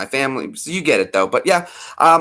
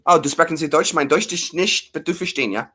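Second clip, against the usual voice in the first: lightly put-on German accent; hint of a German accent, hammed up; 4 semitones higher than usual. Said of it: "why am I trying to be German".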